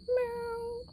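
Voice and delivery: a high cat meow; cat sign-off meow. Pretend. Vlogger giving the cat's sign-off, high meow. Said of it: "remembered how he was gonna sign off".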